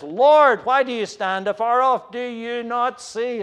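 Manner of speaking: high, loud cry of Lord, then pleading; the psalmist's anguished question; desperate cry. Acted. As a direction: call out to a distant God. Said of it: "the psalmist says".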